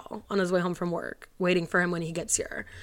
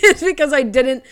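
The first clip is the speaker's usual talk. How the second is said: loud, higher burst of laughing through tears; teary laugh; 6 semitones higher, 9 dB louder than usual. Real, not acted. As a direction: blurt it out through a real tearful laugh. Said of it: "like, tearing up crying".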